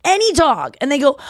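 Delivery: ordinary talk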